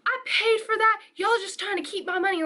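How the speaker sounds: high-pitched, worked-up demand; customer freaking out. Retold or imitated. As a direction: imitate an irate customer demanding, pitch jumping up. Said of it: "like, starts freaking out".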